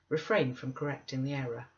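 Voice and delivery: usual speaking voice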